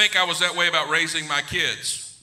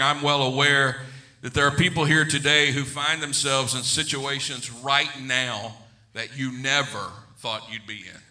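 ordinary talk

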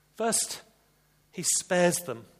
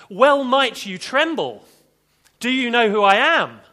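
loud, high, booming mock outburst; thundering rebuke; 7 semitones higher, 13 dB louder than usual. Joseph imagined rebuking his trembling brothers, thundering. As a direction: thunder it out like an outraged ruler.

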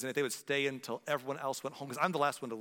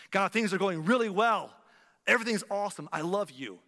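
higher and louder, bursting with joy; exuberant praise; 7 semitones higher, 8 dB louder than usual. Pretend. Believer praising God in good times, excited. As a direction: shout your gratitude.

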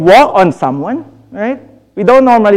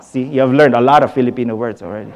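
ordinary talk, nothing marked

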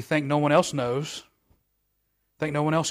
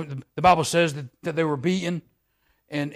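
ordinary talk, nothing marked

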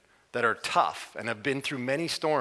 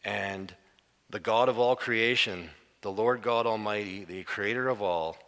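ordinary delivery

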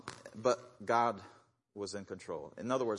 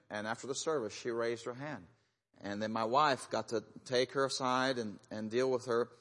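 ordinary delivery

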